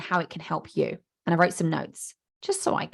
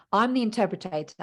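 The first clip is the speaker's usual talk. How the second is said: ordinary delivery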